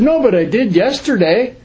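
high, whiny excuse with a rising "no"; defensive excuse. Retold or imitated. Newcomer excusing himself to his sponsor, high and whiny. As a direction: squirm out of it, pleading.

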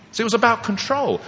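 normal talking voice

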